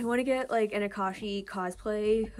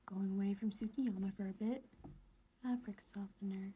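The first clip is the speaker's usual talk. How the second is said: whisper; 2 semitones lower, 8 dB quieter than usual. Real, not acted. Whispering reading captions.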